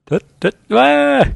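short stutters, then a high drawn-out cry; high cartoon yelp after two blips; cartoon tumble noises. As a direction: imitate a cartoon mishap, ending on a high "ah". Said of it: "Kinda reminded me of Wile E".

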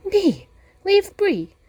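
high, loud, fast alarmed protest; scared innkeeper. Acted. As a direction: recoil in fright, pitch jumping up. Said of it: "looking really scared".

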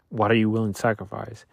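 normal talking voice

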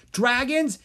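rally the dragons; crying out. A narrator's reading. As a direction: call the dragons to battle. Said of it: "Dharma cried".

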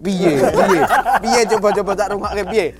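loud, high eager prodding; excited urging. Real, not acted. Host begging friend to rap his verse, loud excited laugh. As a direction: egg him on, laughing.

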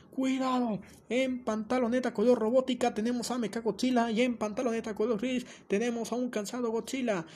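boxing announcer. Acted. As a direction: hype the fighters like a boxing host. Presenta la pelea como boxeo. (voz de anunciador de box, más aguda)

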